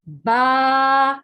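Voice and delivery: "با" with both lips pressed, then opened; letter demo. Shown as a demonstration. Teacher showing students how lips make "با", held clearly. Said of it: "دونوں ہونٹ مل کے جب منہ کھلتا ہے تو ورڈ با ادا ہوتا ہے".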